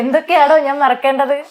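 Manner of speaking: hammed-up film quote with a laugh; amateur mimicry. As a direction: belt out the film line, half laughing. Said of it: "എനിക്ക് mimicry ഒന്നും അറിയില്ല".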